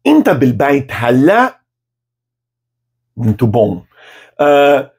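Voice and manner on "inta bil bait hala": "Inta bil bait hala?" slowly, rising at the end; slow rising question; question tune. Shown as a demonstration. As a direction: ask it with a clear rising question. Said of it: "com entonação de pergunta".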